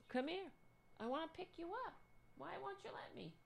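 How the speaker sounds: high coaxing pet voice; pleading with the cat. Real, not acted. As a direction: sweetly beg the cat to be picked up.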